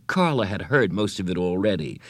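usual speaking voice

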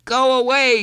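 put-on very high, loud child's yell; angry yell. A narrator's reading. Boy yells through bathroom door, angry. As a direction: yell it high to drive someone off. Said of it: "he yelled".

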